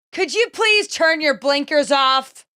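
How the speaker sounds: high, slow, pointed demand; impression of irate older woman. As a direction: imitate a cranky stranger complaining. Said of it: "I'm doing a verbatim impression".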